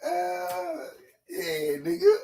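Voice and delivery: high squeaky words through real laughter; can barely get it out for laughing; cracking up at his own joke; laughing squeal. Real, not acted. Streamer cracking up at chat.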